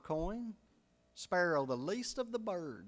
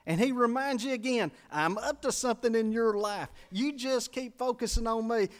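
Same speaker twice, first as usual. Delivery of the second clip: raised, driving sermon voice; preaching at full force; 6 semitones higher, 5 dB louder than usual. Acted. Preacher pressing the point to his church, raised and driving. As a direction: preach it out to the congregation.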